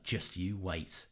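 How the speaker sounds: soft, low, slow teasing promise; quietly knowing, drawn out; with a little grin. Retold or imitated. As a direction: say it slowly with a sly little grin. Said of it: "with a little grin".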